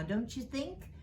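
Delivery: usual speaking voice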